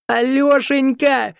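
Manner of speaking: high, drawn-out wailing call; sobbing cry. A narrator's reading. Sobbing woman rushing to the rescuer, high wail. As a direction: wail the name like a distraught woman. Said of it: "Рыдая, какая-то женщина метнулась".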